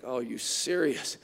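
low, soft, unsure aside; doubtful mutter. Acted. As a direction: say it half to yourself, reluctant. Naaman slinking doubtfully into river.

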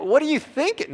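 high, loud mock Italian exclamation; hammed-up Italian-style outburst; botched Italian-sounding accent. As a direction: attempt German but land on Italian. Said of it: "That was more Italian".

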